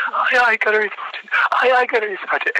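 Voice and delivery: high-pitched recruit's yell; yelled acknowledgement. Retold or imitated. Candidate answering the screaming gunnery sergeant, imitated high yell. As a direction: sound off like a panicked recruit.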